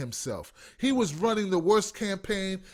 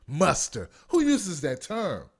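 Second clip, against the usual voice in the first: ordinary talk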